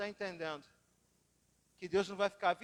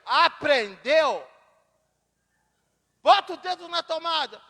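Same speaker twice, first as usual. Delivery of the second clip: stern father; 7 semitones higher, 13 dB louder, slower than usual. Retold or imitated. Father scolds child after shock.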